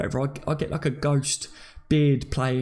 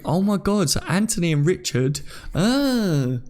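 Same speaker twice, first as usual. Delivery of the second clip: usual speaking voice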